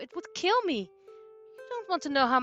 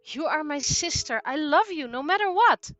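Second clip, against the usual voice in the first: mean every word; reassuring brother; 7 semitones higher, 12 dB louder than usual. Acted.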